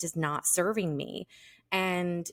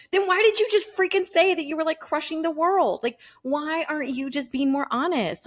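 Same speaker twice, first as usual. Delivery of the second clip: much higher, fast, incredulous outburst; exasperated disbelief; 9 semitones higher, 3 dB louder than usual. Retold or imitated. Host calling out fake-rich entrepreneurs, exasperated and incredulous. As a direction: throw your hands up at the faker. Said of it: "And I'm like".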